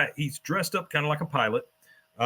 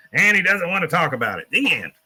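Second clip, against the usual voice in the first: put-on louder, higher gruff old man shutting it down; cranky old soldier ends the interview loudly; gruff dismissal; 5 semitones higher, 5 dB louder than usual. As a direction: bark it out as a cranky veteran. Cotton snaps at interviewer.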